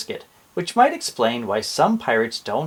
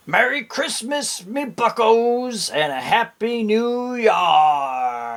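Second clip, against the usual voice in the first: high, slow, hammed-up pirate farewell; pirate Santa shouts goodbye from the sky, high and slow; pirate Santa exclaiming; 7 semitones higher, slower than usual. A narrator's reading. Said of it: "I heard him exclaim".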